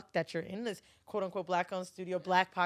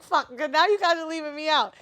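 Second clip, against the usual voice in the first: mock complaint; 7 semitones higher, 9 dB louder than usual.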